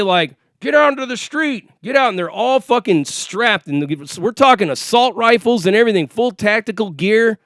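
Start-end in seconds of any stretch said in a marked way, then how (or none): 0.6-2.1 higher-pitched imitation of police orders. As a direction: mimic a cop ordering people out over the PA. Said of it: "on the fucking P A".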